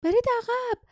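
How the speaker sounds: put-on young woman yelling برید عقب; girl shouting. A narrator's reading. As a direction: cry برید عقب high and urgent. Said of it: "جاسمین فریاد زد".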